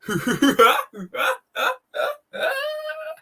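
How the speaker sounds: extremely high mock cackle; Vegan Gays laugh. Streamer ending the impression of the activist, very high mock laugh. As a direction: cap the parody with a squealing, exaggerated laugh. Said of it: "working on my Vegan Gays impression".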